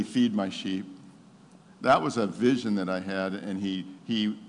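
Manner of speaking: ordinary delivery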